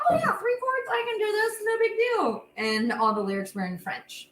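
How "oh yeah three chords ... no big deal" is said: high, cocky self-quote; breezy overconfident voice. Retold or imitated. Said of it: "I was like".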